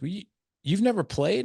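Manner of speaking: high-pitched imitation of disbelief; incredulous. Head coach to a non-player, high incredulous question. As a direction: question leaping up in mock astonishment.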